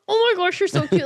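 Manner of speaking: high, loud gushing coo; cooing at baby. Retold or imitated. Mother imitating herself cooing at a cute baby, high and loud. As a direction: gush over a cute baby. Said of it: "I'm like".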